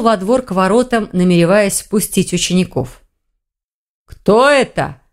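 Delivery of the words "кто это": mild call after him; calling after someone. A narrator's reading. Visitor to the orc walking off, calling after him. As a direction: call out lightly, a little raised. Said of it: "крикнул ему вдогонку Хью".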